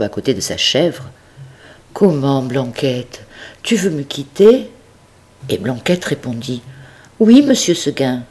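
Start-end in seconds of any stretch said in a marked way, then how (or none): 1.9-4.7 a dismayed master asking his goat; hurt old farmer. Acted. 7.2-8.2 a hint of a higher, louder goat voice; the stubborn little goat answering; lightly voiced little goat. Goat answers her master.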